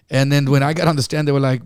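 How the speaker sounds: usual speaking voice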